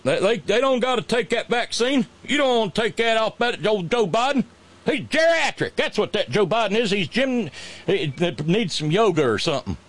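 high, hammed-up folksy scorn; mock Southern governor. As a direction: sneer at Biden like a folksy governor. Governor voice mocking Biden.

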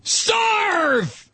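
high, shouted fire-and-brimstone preacher; mock preacher shout. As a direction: bellow it like a ranting preacher. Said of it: "What did Pastor Hagee say?".

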